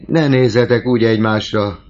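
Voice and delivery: high, loud pleading cry; anguished plea. A narrator's reading. Old father begs his quarrelling daughters, loud and high. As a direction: beg them to stop glaring.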